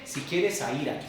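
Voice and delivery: soft, low, take-it-or-leave-it offer; optional suggestion. Acted. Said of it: "No dice". (sugerencia suave y opcional)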